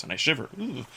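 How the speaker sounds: plain reading voice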